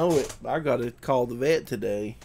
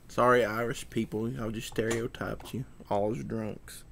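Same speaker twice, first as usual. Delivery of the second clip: usual speaking voice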